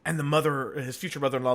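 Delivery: normal talking voice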